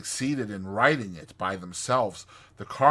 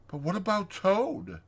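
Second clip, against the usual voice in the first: high, anxious Mole voice; worried little question, pitched up; 10 semitones higher than usual. A narrator's reading. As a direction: fret over a friend. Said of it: "asked the Mole anxiously".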